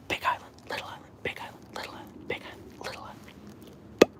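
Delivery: very soft whispered chant. Real, not acted. Owner whispers a playful chant to her dog. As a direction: hush a little chant to the dog.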